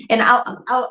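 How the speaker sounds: ordinary talk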